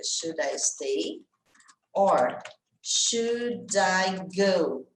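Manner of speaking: very slow, each word stretched; slow demo of the lyric, pausing before "or"; drawn-out song refrain. Shown as a demonstration. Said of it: "ela tem no refrão lá, ela fica".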